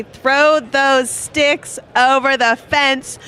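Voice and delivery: slow, drawn-out yelling bettor; Bobby impression. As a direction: bellow it like a fan at the rail.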